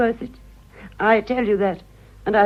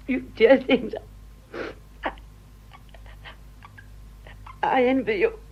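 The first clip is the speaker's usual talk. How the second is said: on the verge of tears; 4 semitones higher, 3 dB quieter than usual. Acted. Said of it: "no, don't cry, Kitty".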